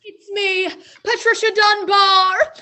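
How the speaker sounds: joyful call. Acted. Survivor calls out joyfully.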